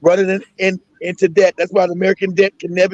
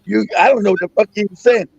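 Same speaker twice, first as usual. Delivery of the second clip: ordinary delivery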